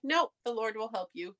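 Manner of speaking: usual speaking voice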